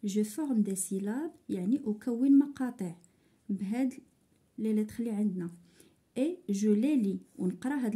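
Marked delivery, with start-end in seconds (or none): none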